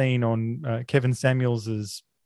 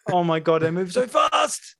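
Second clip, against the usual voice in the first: screaming at a spider; 7 semitones higher, 5 dB louder than usual. Real, not acted.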